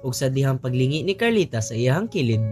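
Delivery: ordinary talk, nothing marked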